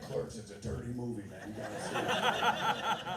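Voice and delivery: loud, slow, laughing hype; hyped recommendation. Retold or imitated. Telling a hundred people about the movie, loud laughing hype. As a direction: sell it like a wild secret.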